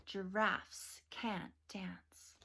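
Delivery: slow hushed title whisper. Acted. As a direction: breathe the title out slowly. Announcing the book title.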